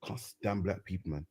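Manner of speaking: mock inner thought, low and soft; imitated private grumble. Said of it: "there's him thinking".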